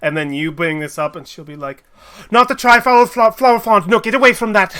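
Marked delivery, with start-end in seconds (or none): 2.3-4.8 high, loud panicked warning; alarmed shout. Acted. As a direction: yell at them to back off the flower. NPC alarmed at the flower.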